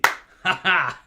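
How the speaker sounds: loud, high burst of laughter; big gleeful stage laugh; excited laugh. Pretend. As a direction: cackle with showy delight. Reacts to an exciting drink.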